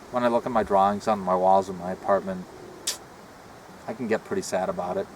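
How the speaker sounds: ordinary delivery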